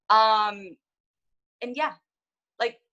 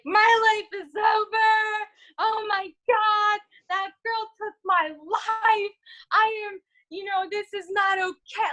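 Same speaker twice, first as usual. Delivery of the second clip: high, wailing panic; mock hysterical wail; 10 semitones higher than usual. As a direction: wail it like your life is ending. Retelling her breakdown at hospital.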